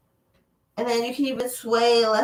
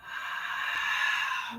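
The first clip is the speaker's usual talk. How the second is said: audible sigh; 9 dB quieter than usual. Shown as a demonstration. Breathing cue in yoga class.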